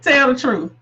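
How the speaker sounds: loud, challenging tell the truth; emphatic call-out. Retold or imitated. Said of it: "as my, my friend Beth was saying".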